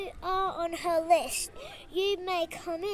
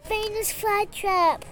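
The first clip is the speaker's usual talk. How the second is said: ordinary delivery, nothing marked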